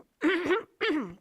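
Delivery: harsh sudden throat clear. Real, not acted. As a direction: cough the bug out of your throat. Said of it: "I've swallowed a bug".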